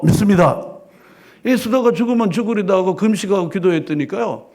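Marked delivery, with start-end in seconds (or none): none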